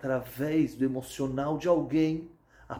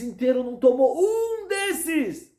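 tearful; 13 semitones higher, 8 dB louder, slower than usual. Acted. Doctor moved by the discovery.

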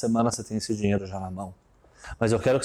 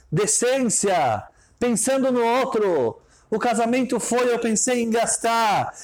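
impassioned preaching; 8 semitones higher than usual. Acted. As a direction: drive the moral home from the pulpit. Preaching the moral at full force. (pregação inflamada, mais aguda e lenta)

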